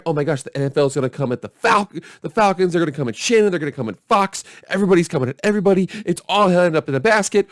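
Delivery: mock the hysterical pundits; mock media panic. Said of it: "they were basically saying".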